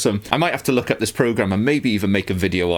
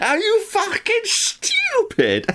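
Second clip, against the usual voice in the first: much higher, slow, "stupid" as "stjupid"; laughing British accent; much slower than usual. Shown as a demonstration. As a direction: squeak it out laughing, London style, "stupid" like "schtupid". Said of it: "It is how they say it".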